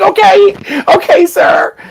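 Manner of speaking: very high, timid okay; meek yes-sir. Retold or imitated. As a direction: squeak out a sheepish okay. Meek compliance to tester.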